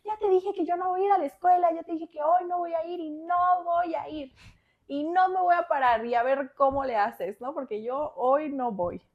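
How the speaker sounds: high, whiny, near-crying refusal; defiant tantrum. Retold or imitated. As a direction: dig in like a stubborn kid close to tears. Teen tantrum refusing school. (berrinche aguda y llorosa)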